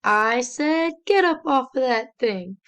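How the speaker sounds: band's rallying shout, imitated; imitated band yell. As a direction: call it out like a marching band. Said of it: "the band will yell".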